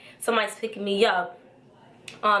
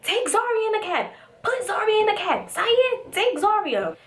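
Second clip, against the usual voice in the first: high, insistent mock commands; urgent ordering; 12 semitones higher than usual. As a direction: imitate frantic friends giving orders, pitched up. Friends urgently giving orders, acted.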